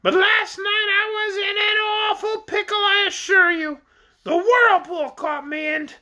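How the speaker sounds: very high, squawky orc voice; high-pitched creature recounting its troubles; shrill creature. A narrator's reading. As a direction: tell it in a piercing bird voice. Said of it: "high-pitched voice".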